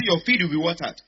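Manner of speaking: loud, forceful repeated blessing; emphatic declaration. Real, not acted. Preacher repeats a blessing for the congregation, emphatic declaration. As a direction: proclaim it loudly over the crowd.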